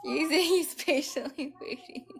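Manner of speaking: high, slow, amused delivery; cracking up. Real, not acted. Streamer watching Ghost wait, cracking up. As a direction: get it out while still tickled. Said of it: "I don't know why I'm cracking up right now".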